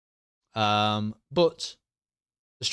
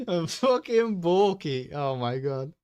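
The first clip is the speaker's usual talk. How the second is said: high, helpless, words squeezed through laughter; real laughing fit; 8 semitones higher, slower than usual. Real, not acted. Streamer cracking up at his own football joke, laughing.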